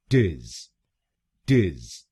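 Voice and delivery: टिज said short, "tiz" with a short i; syllable demo. Shown as a demonstration. Teacher sounding out the British third syllable, slowly. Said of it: "हम बोलेंगे".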